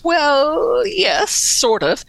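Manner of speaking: high-pitched, drawn-out hedging; noncommittal, slow, high-voiced answer; cagey. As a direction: stall on a high note, then admit it slowly. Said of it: "he very cagily says".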